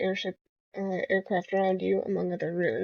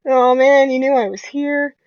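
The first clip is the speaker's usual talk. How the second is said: loud, higher groan of being caught; mock caught out; 4 semitones higher, 10 dB louder than usual. Player caught sneaking up, loud mock groan. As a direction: play the busted sneak.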